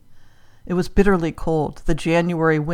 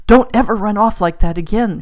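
louder, higher, mildly cross reproof; scolding; 4 semitones higher, 7 dB louder than usual. A narrator's reading. Mother to daughter who ran off, scolding. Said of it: "she scolded".

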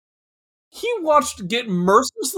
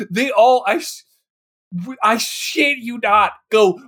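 ordinary talk, nothing marked

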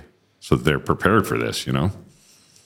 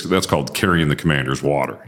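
normal talking voice

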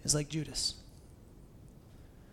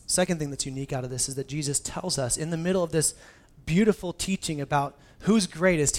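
ordinary talk

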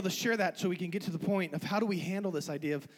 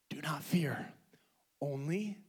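low whispered reassurance; hushed whisper; 6 semitones lower, 6 dB quieter, slower than usual. Acted. Preacher voicing Jesus to Jairus, hushed whisper. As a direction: whisper it slowly, almost without voice.

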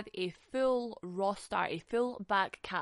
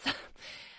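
short breathy chuckle; little airy laugh at your own joke; 3 dB quieter than usual. Real, not acted. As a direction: chuckle to yourself briefly. Laughs after her own joke.

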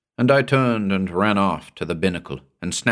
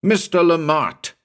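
high, alarmed calling-out; urgent shout; 9 semitones higher than usual. A narrator's reading. Narrator finding the mate gone, urgent shout. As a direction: cry out for the missing man. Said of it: "I shouted again".

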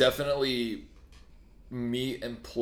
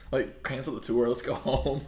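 ordinary delivery

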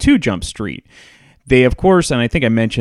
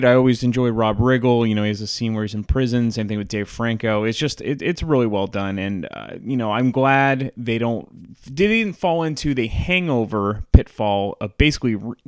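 normal talking voice

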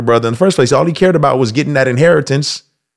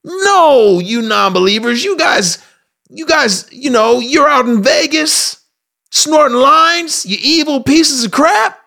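very high, indignant condemnation; mock self-righteous ranting; 9 semitones higher than usual. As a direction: scold the sinners, pitched way up. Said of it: "that's what a lot of us are".

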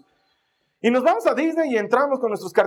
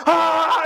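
high, held "aaah"; reenacted scream; 9 semitones higher, 4 dB louder than usual. Preacher showing he kept screaming in vain, high scream. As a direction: keep up the mock ride scream. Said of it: "seguía gritando".

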